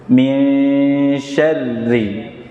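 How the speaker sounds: Min syarri very slow, rr held long; model reading. Shown as a demonstration. Teacher modelling Min syarri for students to follow. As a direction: stretch Min syarri slowly. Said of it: "Memang butuh latihan".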